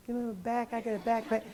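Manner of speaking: high, soft mock voice of a woman; imitating little woman. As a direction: meekly voice a little patient. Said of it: "And she".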